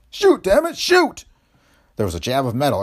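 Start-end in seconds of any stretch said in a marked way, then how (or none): 0.1-1.2 very high, loud snarling shout; snarled order. Acted. Masked gangster urging his partner to fire, furious snarl. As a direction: snarl it at full force. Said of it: "Another voice snarled".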